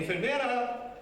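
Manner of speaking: a lifted, almost cheerful cry for the nurse; calling out to nurse. Retold or imitated. Calling nurse before dying.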